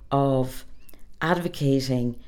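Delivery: ordinary talk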